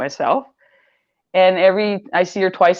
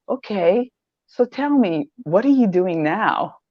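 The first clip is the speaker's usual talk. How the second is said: higher, drawn-out and curious; a higher, slower, eager version of the question; new interested tone; 4 semitones higher, slower than usual. Retold or imitated. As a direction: retell her asking it in a new, lifted way. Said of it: "but she said it this way".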